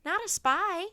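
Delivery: high, loud denial; protesting. A narrator's reading. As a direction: insist over the soldiers. Annie keeps protesting.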